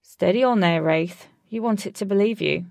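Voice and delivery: loud, cheeky heckle. A narrator's reading. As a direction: tease the boaster cheekily, out loud. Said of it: "Alec said cheekily".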